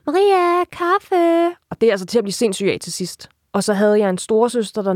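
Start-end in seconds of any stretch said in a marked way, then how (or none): 0.1-1.6 high, drawn-out call from another room; imitated mother calling out. Daughter mimics her mother ordering coffee, high drawn-out call. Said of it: "og så råber hun".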